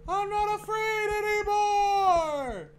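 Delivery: high, slow, loud wail; hammed-up brave wail; wailing battle cry. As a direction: howl it like a war cry. Yelling defiance at the game.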